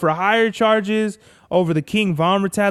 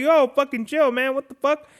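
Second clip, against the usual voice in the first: higher-pitched protesting dog voice; taken-aback dog; 8 semitones higher than usual. Acted. Big dog answering Presley's freakout, high put-on protest. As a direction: play a dog put off by the outburst. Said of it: "and the dog is like".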